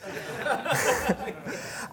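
short unvoiced laugh at his own joke; soft breathy chuckle. Real, not acted. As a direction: chuckle quietly to yourself.

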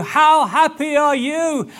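high, loud and slow; joyful wonder. Acted. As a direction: marvel out loud. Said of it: "he started by telling you".

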